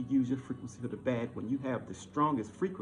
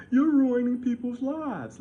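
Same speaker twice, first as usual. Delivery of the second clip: high, slow and louder mocking imitation; mocking whiny quote; 8 semitones higher, 8 dB louder, slower than usual. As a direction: drag it out to ridicule him.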